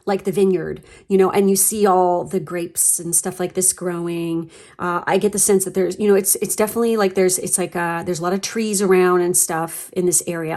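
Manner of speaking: ordinary delivery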